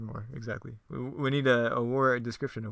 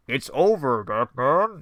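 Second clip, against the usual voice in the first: Mister Freeze voice; 3 semitones higher, 7 dB louder, much slower than usual. Acted. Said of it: "what Mister Freeze sounds like".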